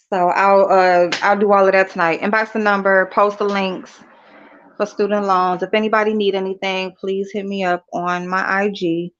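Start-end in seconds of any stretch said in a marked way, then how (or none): none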